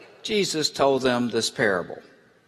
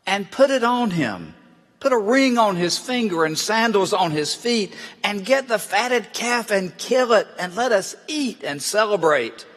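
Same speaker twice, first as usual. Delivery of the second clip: lightly voiced excited father, higher pitch; urgent joyful command; 6 semitones higher than usual. A narrator's reading. Father ordering slaves to bring the best robe, mildly excited. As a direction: read his orders with raised, eager urgency.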